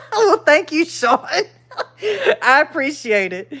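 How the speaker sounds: high, laughing thank you. Real, not acted. Thanking Sean for subscribing, laughing. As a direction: thank him through laughter.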